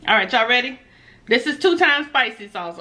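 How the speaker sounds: usual speaking voice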